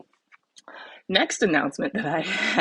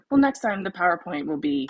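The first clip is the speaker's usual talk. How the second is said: normal talking voice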